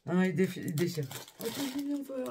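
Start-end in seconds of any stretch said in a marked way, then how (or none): 1.4-2.2 high cutesy coo at the bear. Real, not acted. Talking sweetly to the bear.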